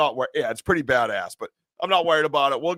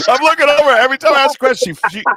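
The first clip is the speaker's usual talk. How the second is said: high, loud, fast, breaking into laughter; laughing teasing; 9 semitones higher, 8 dB louder, faster than usual. Real, not acted. Host teases guest for freezing up, laughing loudly. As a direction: blurt it out fast through laughs.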